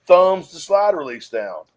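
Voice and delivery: ordinary delivery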